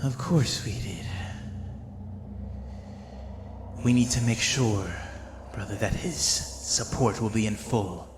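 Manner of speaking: a second cultist quietly plotting the movement, lightly voiced; soft coarse voice. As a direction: keep it hushed and rough. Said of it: "kind of more soft and more coarse".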